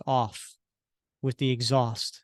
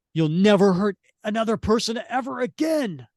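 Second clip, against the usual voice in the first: higher, louder, fast vow; hero's yell; 7 semitones higher, 4 dB louder, faster than usual. Acted. Steve yelling at Arnim Zola, loud vow. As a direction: shout the vow down at the villain. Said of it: "Steve yells".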